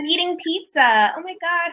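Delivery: high-pitched imitation of a complainer; mock whining. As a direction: whine it in a pretend dramatic voice. Mimicking the woman whining in quarantine.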